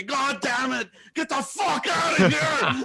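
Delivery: high-pitched mock shouting; imitated angry yelling. Roommate yelling at everybody at the party, hammed-up angry shout. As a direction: yell furiously, dragging out the final order. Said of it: "he starts yelling at everybody".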